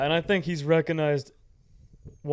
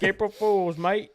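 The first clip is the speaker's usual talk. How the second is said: higher, louder cheeky British punchline; mock British accent; 5 semitones higher, 3 dB louder, slower than usual. Host teasing Brits about teeth in a mock British accent. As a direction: deliver the prank reveal like a Brit. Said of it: "And then you're like".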